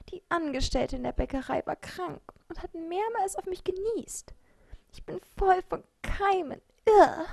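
high, plaintive creature voice; wailing bread. A narrator's reading. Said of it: "klagte es". (hoch jammerndes, klagendes Brot)

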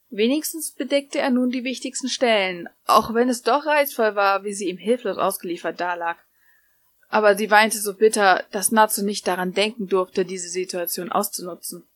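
ordinary delivery

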